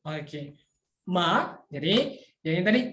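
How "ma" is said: MA as a rising Mandarin second tone. Shown as a demonstration. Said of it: "nada dua itu".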